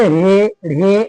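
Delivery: रे then ढे, drawn out very slowly; slowly sounding out रे, ढे for the class; slow sound demo. Shown as a demonstration. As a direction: spell out रे and ढे syllable by syllable. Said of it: "तो क्या पढ़ेंगे?".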